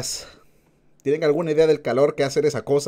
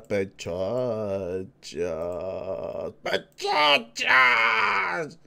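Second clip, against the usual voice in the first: goofy stretched greeting; 7 semitones lower, 6 dB quieter, much slower than usual. Pretend.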